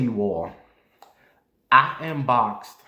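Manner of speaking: ordinary delivery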